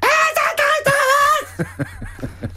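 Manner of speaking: high-pitched comic screaming noise; mock screaming. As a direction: scream wordlessly like a manic rock singer. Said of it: "that's how he talks to you".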